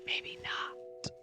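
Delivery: backtrack in a whisper; voiceless, cautious reply. Acted. Stranger retracts claim to scared drinker, whispering.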